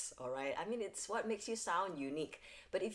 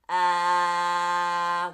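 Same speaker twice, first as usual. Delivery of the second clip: neutral tongue vowel; 14 dB louder than usual. Shown as a demonstration. Coach demonstrates a neutral-tongue vowel, loud and open. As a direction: sustain a clean ah, no nasal buzz. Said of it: "this is a neutral tongue".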